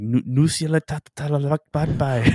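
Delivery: high gibberish babble; hammed-up speaking in tongues; mock tongues. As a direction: rattle off nonsense syllables. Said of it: "was like".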